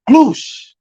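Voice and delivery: high, loud swooping sound effect; mock splash-swoop noise; swoosh noise. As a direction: whoosh like a bird diving. Imitating bird swooping fish.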